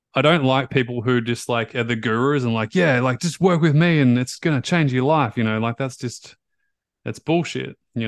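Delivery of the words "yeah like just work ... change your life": lightly mocked guru hype, higher and louder; guru sales pitch. Coach mimicking gurus who promise too much, mock sales pitch.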